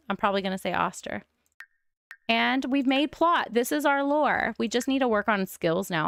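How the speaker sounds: ordinary delivery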